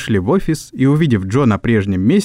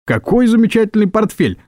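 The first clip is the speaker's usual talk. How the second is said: high-pitched admiring exclamation; acted, eager praise for the boss; gushing flattery; 10 semitones higher than usual. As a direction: gush over it in a high voice. Nervous underlings flattering the boss.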